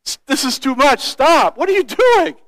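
mock plea to stop, pitch climbing; overwhelmed protest. Friend protesting an overly generous gift of food, overwhelmed. Retold woman overwhelmed and crying.

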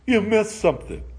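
Luther's mild triumph over the devil; triumphant exclaim. Acted. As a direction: announce it like a winner, a touch lifted. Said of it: "Luther exclaimed triumphantly".

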